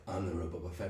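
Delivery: low, soft take on the line; quiet claim. Retold or imitated. Imagined Boba Fett quietly claiming his own name. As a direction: say it under your breath. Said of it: "Boba Fett's like".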